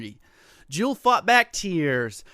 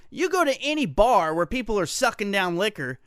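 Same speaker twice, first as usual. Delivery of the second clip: usual speaking voice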